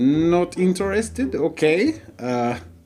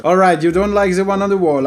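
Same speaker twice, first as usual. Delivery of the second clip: ordinary delivery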